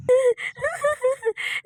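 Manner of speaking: high, long falling laugh; mocking cackle. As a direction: cackle at your own joke. Laughs after mocking Rani. (ऊँची, लंबी ठहाकेदार हँसी)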